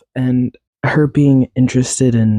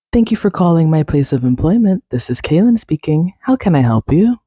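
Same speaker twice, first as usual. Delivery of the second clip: friendly, higher-pitched phone greeting; customer service voice; 4 semitones higher than usual. Shown as a demonstration. Employee answers a work call in a friendly customer service voice. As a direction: chirp it like a receptionist picking up the work line. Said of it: "I could turn on my customer service voice".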